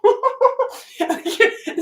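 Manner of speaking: real high-pitched burst of laughter. Real, not acted. Teasing viewers about draining people, high laugh. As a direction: laugh at your own punchline. Laughs at her own joke.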